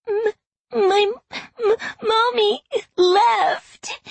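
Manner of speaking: put-on high, stammering child's sob; bursting into tears. A narrator's reading. Little girl tells her grandmother mommy is gone, tearful and stammering. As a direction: cry it slowly as a little girl, catching on the M. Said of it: "Grace burst into tears".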